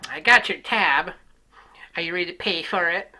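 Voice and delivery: slap the bill down and demand it loudly; rude waitress. Retold or imitated.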